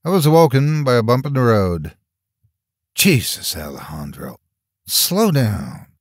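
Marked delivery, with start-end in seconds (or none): 2.9-5.9 mildly irritated complaint; a touch of exasperation; complaining. A narrator's reading. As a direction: grumble at a careless driver. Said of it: "complained the voice of whom".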